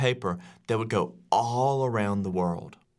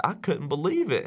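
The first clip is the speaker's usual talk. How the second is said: ordinary delivery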